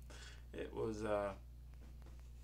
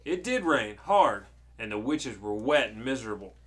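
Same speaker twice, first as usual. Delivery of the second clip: loud, emphatic storytelling; storybook narration; 11 dB louder than usual. Acted. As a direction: read it dramatically to a child. Reads the story to child.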